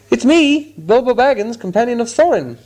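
high, loud urgent cry. A narrator's reading. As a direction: shout it out so he's found. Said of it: "he cried".

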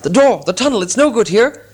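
high, loud hurried warning; urgent alarm. Acted. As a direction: push them toward the tunnel. Bilbo urging dwarves to flee.

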